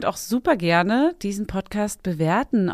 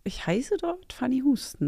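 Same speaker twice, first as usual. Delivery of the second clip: whisper; 4 semitones higher, 6 dB quieter than usual. Real, not acted. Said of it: "whispered answer".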